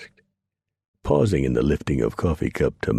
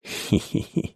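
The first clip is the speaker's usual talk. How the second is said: a quiet breathy chuckle; soft chuckle; 6 semitones higher, 3 dB quieter than usual. Acted. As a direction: chuckle under your breath, amused. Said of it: "He chuckled".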